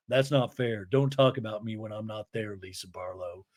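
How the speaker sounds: low, soft put-on voice; muttered objection. Woman objecting to friend's remark, in a low muttered protest. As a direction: sink in pitch and volume toward the name.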